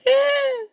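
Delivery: high-pitched noncommittal squeak; dismissive "eh". Shown as a demonstration. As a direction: squeak out a verbal shrug. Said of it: "shrug my shoulders and be like".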